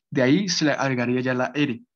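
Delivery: ordinary talk, nothing marked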